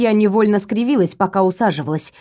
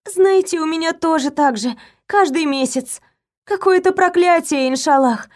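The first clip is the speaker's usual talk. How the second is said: sympathetic; 7 semitones higher than usual. A narrator's reading. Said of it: "сочувственно вздохнула".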